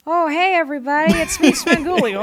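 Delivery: high, loud normal-guy greeting; imitated unaccented host greeting; Svengoolie. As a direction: hail the audience as a TV host without accent. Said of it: "How's he sound now?".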